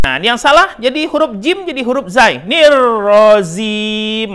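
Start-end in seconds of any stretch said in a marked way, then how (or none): 2.4-4.3 "Nirrozim" slow, with a Z sound; wrong pronunciation. Shown as a demonstration. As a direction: say "Nirrozim" with Z, as the mistake. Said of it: "malah salah".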